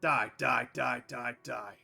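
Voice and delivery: loud raging repeated die; loud furious chant fading out; furious yelling. Acted. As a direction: scream it with each blow. Knight attacking in rage.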